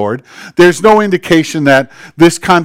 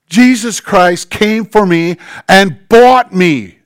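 ordinary talk

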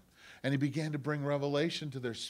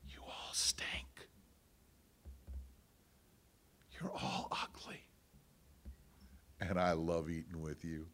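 whisper; 6 semitones lower, 8 dB quieter than usual. Acted. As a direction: whisper it tenderly, like a secret. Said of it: "God whispered to him and said".

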